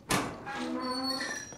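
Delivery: sudden frightened gasp; sharp gasp. Real, not acted. Scare in horror game.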